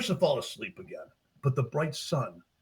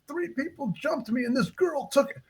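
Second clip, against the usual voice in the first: put-on high, upset little-boy voice; choked-up confession; 12 semitones higher than usual. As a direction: imitate a child explaining through a lump in the throat. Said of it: "he gets a lump in his throat".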